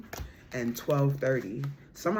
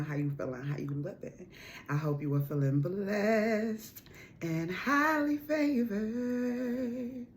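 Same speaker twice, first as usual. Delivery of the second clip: vlog intro; 3 dB quieter than usual. Acted. Sister Queen Ebony greeting her subscribers, softly. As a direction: welcome the tribe gently.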